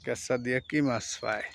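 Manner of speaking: ordinary delivery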